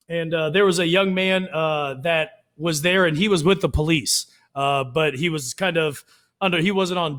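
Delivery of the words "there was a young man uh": usual speaking voice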